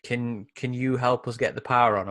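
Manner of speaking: ordinary delivery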